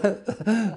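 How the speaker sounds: a bright real burst of laughter. Real, not acted. Bishop laughing while recalling Pierre's dinner questions, real laugh. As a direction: laugh out loud at the memory.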